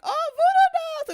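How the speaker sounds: high, loud mock-tiny squeal. Friend retelling his voodoo doll joke, imitated tiny voice. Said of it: "it was in tiny".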